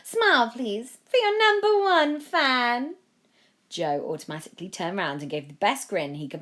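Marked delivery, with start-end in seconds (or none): 0.0-3.0 much higher, louder slow syrupy plea; sinister fan. A narrator's reading. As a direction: coo it like an obsessed fan.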